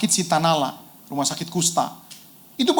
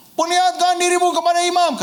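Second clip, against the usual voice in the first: high, loud command sent across a distance; called out loud; 12 semitones higher than usual. Retold or imitated. Jesus calling to the distant lepers, loud high command. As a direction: shout the instruction so they hear it far away. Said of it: "Tuhan Yesus juga teriak".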